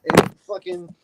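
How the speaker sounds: high, loud outburst of rage; furious yell. Real, not acted. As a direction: blow up at him. Exploding in rage at co-host.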